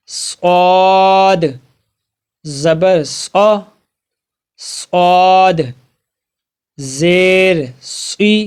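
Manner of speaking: slow, deliberate spelling-out of each letter and vowel; letter drill recitation. Shown as a demonstration. Teacher drilling students on Arabic letters, slow and deliberate. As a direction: sound out every letter like a teacher drilling children.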